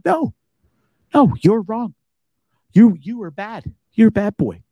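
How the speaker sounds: mock telling-off. Film franchise telling off the host, mock scolding. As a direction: scold him like a naughty child, hammed-up. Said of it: "they yelled at me personally".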